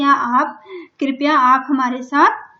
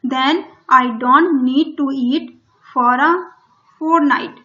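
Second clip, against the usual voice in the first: very slow, louder, every word spaced out; slow dictation; 4 dB louder, much slower than usual. Shown as a demonstration. Teacher reading the story line to Hindi-speaking pupils, very slow and loud. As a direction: read it word by word for the class. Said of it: "teacher reads English line slowly".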